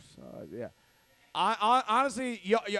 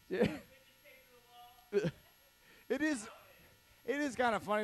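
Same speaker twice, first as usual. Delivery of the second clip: ordinary talk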